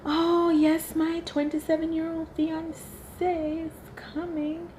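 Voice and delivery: sneering parody of a proud bride; mock snooty announcement; mocking boastful woman. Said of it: "why do they keep having to say".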